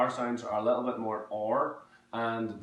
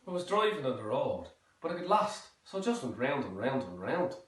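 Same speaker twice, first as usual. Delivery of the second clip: lilting Southern Irish; Southern Irish with OI vowels; Southern Irish accent; 3 semitones higher than usual. Shown as a demonstration. Telling of getting lost on the road, Southern Irish accent. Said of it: "moving on to the Southern Irish accent".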